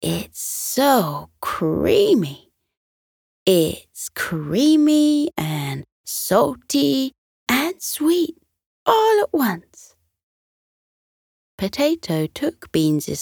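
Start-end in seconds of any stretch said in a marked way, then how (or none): 0.0-10.0 slow, rising higher with delight; savouring every word; slow then soaring delight; rapturous. A narrator's reading. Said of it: "gleaming eyes".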